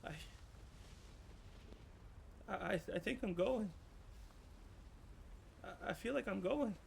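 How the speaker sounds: put-on a scared ghost child fighting tears; a hint of sobbing boy; crying child. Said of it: "and he's crying".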